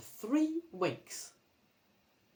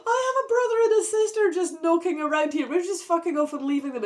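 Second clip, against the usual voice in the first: fast, high, loud alarm; realising the siblings are left behind; shocked outburst; 10 semitones higher, 7 dB louder, faster than usual. Real, not acted. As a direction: rattle it off in mock horror.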